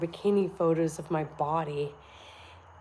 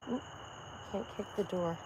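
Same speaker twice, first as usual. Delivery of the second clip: normal talking voice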